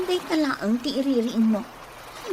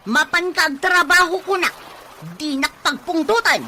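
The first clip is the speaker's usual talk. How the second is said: loud, higher angry yelling; angry shout; 5 semitones higher, 8 dB louder than usual. Acted. Wife ordering lazy husband to work, angry shout. Married couple quarrelling.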